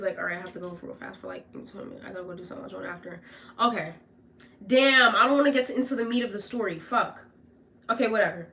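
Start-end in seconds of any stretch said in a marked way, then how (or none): 0.2-3.2 hushed hurried brush-off. Retold or imitated. Boyfriend hurrying off the phone.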